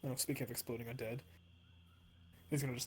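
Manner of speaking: ordinary talk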